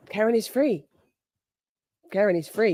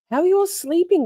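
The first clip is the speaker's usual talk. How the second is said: bright question; 11 semitones higher, 5 dB louder, faster than usual. Real, not acted. Reading poll question to chat.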